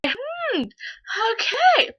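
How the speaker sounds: very high, uneasy "hmm, okay"; awkward high-pitched okay. Retold or imitated. Replaying her reply to the classmate's smelling compliment, squeaky and awkward. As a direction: squeak out a reluctant acknowledgment.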